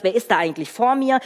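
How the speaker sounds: ordinary talk, nothing marked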